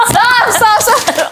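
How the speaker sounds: high laughing stop stop; laughing pleas. Real, not acted. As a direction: beg him to stop through laughter. Laughing while brother tears it.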